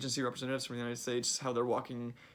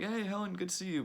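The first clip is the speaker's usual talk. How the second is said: much higher, bright mock-friendly hello; fake friendly greeting; 8 semitones higher than usual. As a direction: greet her with put-on cheer you don't mean. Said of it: "they're all like".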